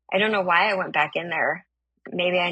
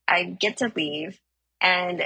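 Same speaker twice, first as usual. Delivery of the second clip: usual speaking voice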